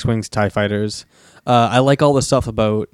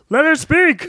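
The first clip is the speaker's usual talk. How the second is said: very high squeaky mock call; a squeaky shout from the back; 3 dB louder, slower than usual. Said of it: "It's like".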